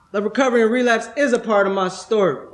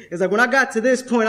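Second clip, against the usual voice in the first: ordinary delivery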